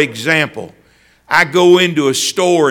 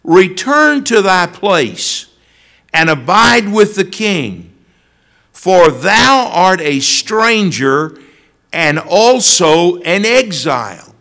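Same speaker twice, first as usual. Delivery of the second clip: scripture reading; 2 semitones higher, 3 dB louder than usual. Acted. Preacher reading the verse to the congregation, measured. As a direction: read the old words with weight.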